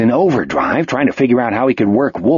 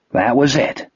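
ordinary delivery

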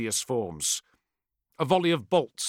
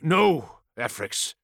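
firm warning tone; 2 semitones higher, slower than usual. Acted. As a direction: caution him sternly. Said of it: "he said warningly".

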